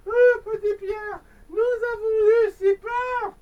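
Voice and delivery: a worried mum greeting her son at dawn; anxious mother. Acted. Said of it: "dit-elle en l'embrassant".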